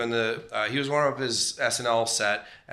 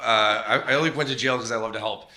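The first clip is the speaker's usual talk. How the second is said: usual speaking voice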